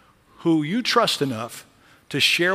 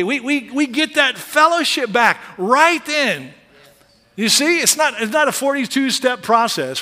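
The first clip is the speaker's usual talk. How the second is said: louder, higher preaching, near a shout at parole; full-force preaching; 7 semitones higher, 5 dB louder than usual. Acted. Preacher rejecting a probation gospel, preaching loud and high. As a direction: thunder it from the pulpit.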